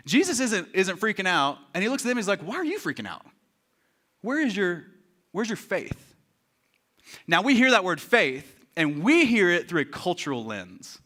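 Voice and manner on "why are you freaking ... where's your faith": soft, puzzled reproach; calm questioning. Acted. Jesus asking the disciples after the storm, calm questioning. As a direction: ask it quietly, letting it hang.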